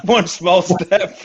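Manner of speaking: slowed, laughing through the words; laughing repeat. Shown as a demonstration. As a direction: repeat the line while cracking up. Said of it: "it's one small step for man".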